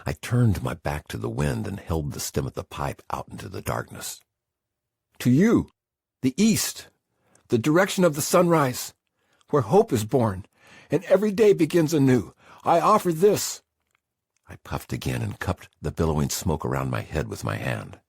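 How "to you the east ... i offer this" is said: high, loud, ringing invocation; fervent prayer. Acted. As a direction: lift the words up to the sunrise. Man prays to the east.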